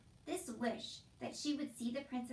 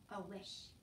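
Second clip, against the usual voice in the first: ordinary delivery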